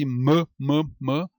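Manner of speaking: "m, m, m" como mâ, mâ, mâ isolados; som de consoante. Shown as a demonstration. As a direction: repita "m, m, m" em sílabas curtas. ("m, m, m" dito mâ, separado)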